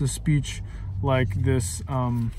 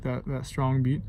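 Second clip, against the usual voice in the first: ordinary delivery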